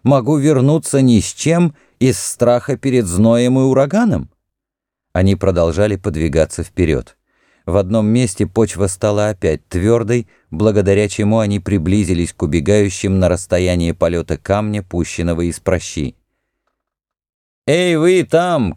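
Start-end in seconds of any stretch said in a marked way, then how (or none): 0.0-4.3 higher-pitched, disdainful challenge; near contempt. A narrator's reading. Prince to priest who urges turning back, near contempt. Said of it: "посмотрел на жреца почти с презрением". 17.7-18.7 high, drawn-out call. A narrator's reading. Heir to fleeing enemies across the sand, calling out. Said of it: "крикнул наследник".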